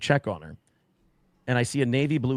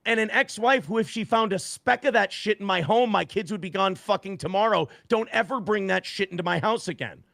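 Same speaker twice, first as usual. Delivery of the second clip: put-on raised, heated warning about his kids; retold stern warning; 6 semitones higher than usual. Father warning a guest about drugs, mildly heated. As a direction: lay down the law, a touch heated. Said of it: "I go".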